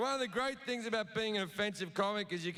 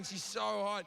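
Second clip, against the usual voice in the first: ordinary talk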